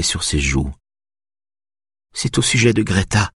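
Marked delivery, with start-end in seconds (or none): 2.1-3.3 higher, restrained distress; understated tearful mother; struggling to speak. A narrator's reading. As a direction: manage to get the hard news out. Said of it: "réussit-elle enfin à articuler".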